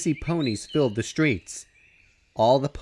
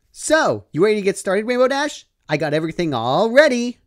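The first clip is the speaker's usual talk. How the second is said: eager pink pony; 8 semitones higher, 5 dB louder than usual. Acted. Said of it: "the pink pony said".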